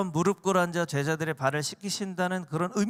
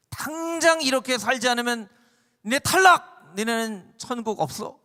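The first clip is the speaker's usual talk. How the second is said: loud, higher scolding shout; mock stern shout; 6 semitones higher, 8 dB louder than usual. Preacher mimics a harsh God threatening the congregation, loud mock shout. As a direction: bark it like a harsh judge. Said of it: "이렇게 하시는 게 아니에요".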